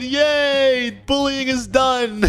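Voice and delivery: put-on high-pitched childlike celebration; happy cheer. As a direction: cheer like a relieved little kid. Celebrates end of bullying.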